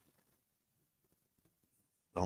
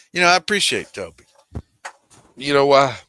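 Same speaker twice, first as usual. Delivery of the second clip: high, loud and fast praise; excited; 6 semitones higher, 11 dB louder, faster than usual. Real, not acted. Streamer hyping up his friend Toby, excited. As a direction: pump it up like a hype man.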